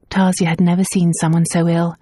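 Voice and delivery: usual speaking voice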